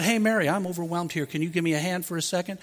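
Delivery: a preacher voicing Martha asking her sister nicely for help; gentle request. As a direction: ask your sister kindly for a hand. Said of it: "She could have come over and said".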